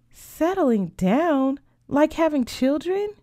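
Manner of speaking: high, drawn-out echo question; incredulous. Acted. Sophia questions friend about settling down, incredulous. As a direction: repeat it slowly and high, in disbelief.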